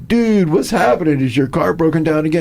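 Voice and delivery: high, loud, mocking friend's call; teasing buddy. Buddy teasing his friend about the broken car, high and mocking.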